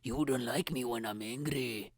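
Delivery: put-on Scottish Hulk voice; mock-angry Scottish threat; Hulk-Scottish mix. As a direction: play Hulk with a thick Scottish accent. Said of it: "why do I sound like a mixture between Hulk and a Scottish guy".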